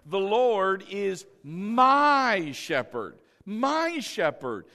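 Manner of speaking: slow and loud, MY hugely stretched; stress on MY. Shown as a demonstration. Preacher has congregation point at themselves, long emphatic MY. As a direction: swell on "my", then repeat it. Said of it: "I want you to point at yourself".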